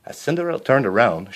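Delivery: ordinary delivery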